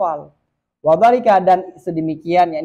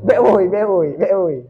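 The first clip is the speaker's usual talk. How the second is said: wordless call. Shown as a demonstration. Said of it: "Ini yang tidak ada hurufnya".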